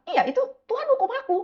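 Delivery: lightly ape the worried vendor; imitating the vendor. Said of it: "bilang gitu".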